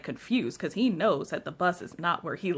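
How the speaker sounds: ordinary talk, nothing marked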